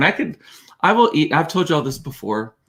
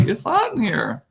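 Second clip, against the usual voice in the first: high, mock-whiny complaint; imitating Todd's complaint; 9 semitones higher, 4 dB quieter than usual. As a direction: play the husband suddenly overheated. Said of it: "suddenly he's like".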